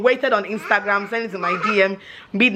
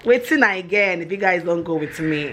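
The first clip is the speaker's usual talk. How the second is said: normal talking voice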